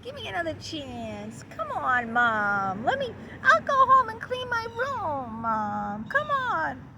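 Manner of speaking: put-on high, whiny begging kid voice; pouting child. The kid begging Mom for the candy bar, high whine. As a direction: plead with Mom like a sulking child. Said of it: "sit there and pout and say".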